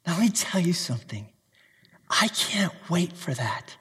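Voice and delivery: quiet and close, slowing on the last words; hushed eagerness; soft, longing confession. Real, not acted. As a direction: confide it like a personal longing.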